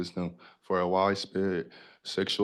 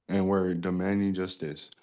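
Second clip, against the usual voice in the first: usual speaking voice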